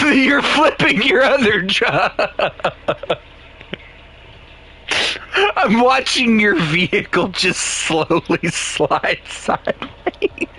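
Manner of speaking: high, slow, helpless laughter through the words; real laughing. Real, not acted. Watching a friend's vehicle slide sideways, laughing helplessly. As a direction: squeal it out, breaking up laughing.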